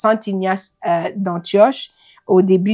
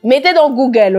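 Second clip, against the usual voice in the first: higher and louder, amused urgency; excited; 6 semitones higher, 9 dB louder than usual. Real, not acted. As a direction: blurt it out, half laughing. Laughing over own word slip.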